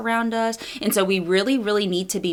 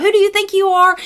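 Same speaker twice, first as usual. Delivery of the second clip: much higher, louder, indignant snap; outraged mom; 13 semitones higher, 7 dB louder than usual. Retold or imitated. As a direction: go from zero to hundred on it. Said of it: "go from zero to hundred".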